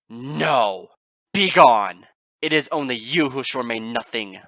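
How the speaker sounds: extremely loud commanding cry; furious shout. Acted. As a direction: blast them away with the words. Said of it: "Twilight shouted".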